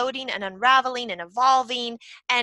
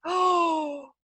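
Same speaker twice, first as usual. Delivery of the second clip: gasped oh; 5 semitones higher than usual. Retold or imitated. Gasping at son's sweet words.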